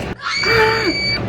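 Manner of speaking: high, sharp imitated gasp; short high-pitched mock shriek; mock freak-out. Employee mimics her manager in a rush, mock freak-out.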